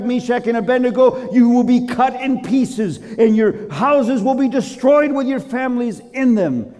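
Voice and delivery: high, loud royal decree; king declaring to the whole realm; proclaiming. Acted. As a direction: preach out the proclamation, ringing and emphatic. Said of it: "And he proclaimed".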